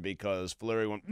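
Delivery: ordinary talk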